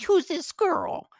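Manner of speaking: put-on very high, drawn-out kid question; kids' voice. Kids asking dad about his date, sing-song nosy.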